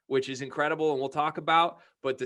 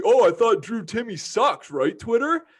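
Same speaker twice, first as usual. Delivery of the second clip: higher, louder taunting imitation; mock sarcasm; 7 semitones higher, 6 dB louder than usual. Imitating Gonzaga fans gloating on Twitter, sarcastic mockery. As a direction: throw it out as a sarcastic jeer. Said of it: "they're saying sarcastically".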